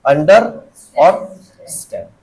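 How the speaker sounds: under and stand said slowly, pulled apart; two separate words. Shown as a demonstration. Teacher showing the verb's two words, slowly split. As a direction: pause between under and stand, drawing each out. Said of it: "दो शब्द हैं इसमें".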